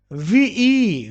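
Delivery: ordinary talk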